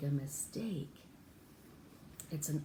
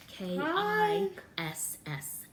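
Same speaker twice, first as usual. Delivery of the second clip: slow letter by letter, higher; spelled out; 11 semitones higher, 3 dB louder, much slower than usual. Shown as a demonstration. Teacher spelling a child's new name, slow and high. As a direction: spell each letter out slowly. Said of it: "spelling the name".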